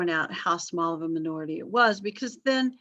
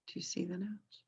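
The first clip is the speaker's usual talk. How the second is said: whisper; 15 dB quieter than usual. Real, not acted. Checking with class quietly.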